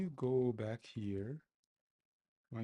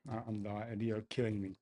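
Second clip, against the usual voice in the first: ordinary delivery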